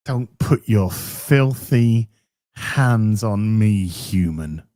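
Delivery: low, slow, disdainful cat; cat sneering at the owner who tries to pet her; haughty cat voice. Retold or imitated. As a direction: speak down to the human like a scornful cat. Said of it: "She goes".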